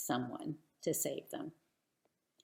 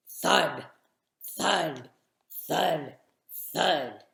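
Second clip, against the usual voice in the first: louder, heavy falling thuds; heavy giant footsteps; 5 dB louder, much slower than usual. A narrator's reading. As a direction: stamp each word like a giant's step.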